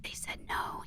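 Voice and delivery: whispering like her voice is gone; lost voice whisper. Retold or imitated. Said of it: "looking at the camera going".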